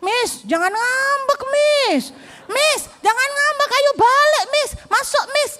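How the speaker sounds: high, wailing mock plea; high drawn-out pupil voice, hammed up; imitating teasing pupil. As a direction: plead like a kid calling the teacher back. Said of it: "ini anak bilang".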